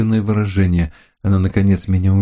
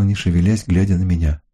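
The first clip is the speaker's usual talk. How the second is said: plain reading voice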